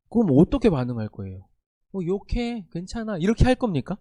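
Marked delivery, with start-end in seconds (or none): 1.9-3.2 soft, dismissive mock reply; a hushed, mockingly careless answer; mock shrug. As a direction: brush it off quietly, as if nothing matters. Mock indifferent reply to insult.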